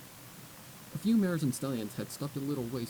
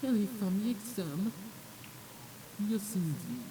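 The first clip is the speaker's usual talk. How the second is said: higher, slow haughty noble voice; snobbish gossip; 9 semitones higher, slower than usual. Acted. A posh noble mare gossiping, haughty and higher. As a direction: sniff down at the filly.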